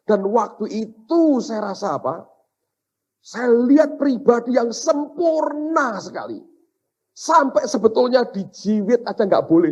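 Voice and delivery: high, loud, emphatic sermon delivery; impassioned preaching. Real, not acted. Preacher recounts his dream to the congregation, impassioned.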